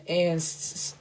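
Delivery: real stutter, catching on the sound. Real, not acted. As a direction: trip over the first sound.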